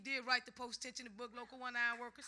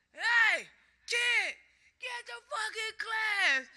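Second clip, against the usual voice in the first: loud, high shout, drawn out; imitated bellow; 9 semitones higher, 10 dB louder, much slower than usual. Warlord yelling at a bystander on site, imitated bellow. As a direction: holler it across a job site.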